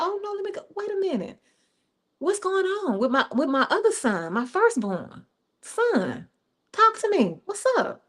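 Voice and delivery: high, soft concern; tender father. Acted. Father going out to his sulking son, soft and high. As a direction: gently coax the hurt son.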